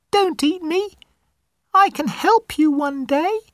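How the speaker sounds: very high, squeaky little mouse; a frightened mouse begging for its life; tiny mouse voice. Acted. Mouse begging the angry lion not to eat it, very high and squeaky.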